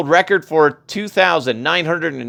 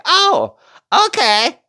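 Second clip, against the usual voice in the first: mock surprise; 12 semitones higher, slower than usual. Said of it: "they're gonna be like".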